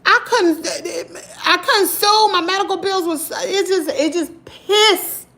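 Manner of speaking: high, bitter outburst with a broken laugh; bitter anger. Real, not acted. Vlogger telling viewers she got no justice, high and bitter. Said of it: "it just pissed me off so bad".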